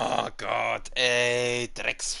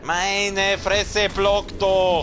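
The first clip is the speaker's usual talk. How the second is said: panicking gamer; 5 semitones higher, much slower than usual. Real, not acted. Boss fight going badly.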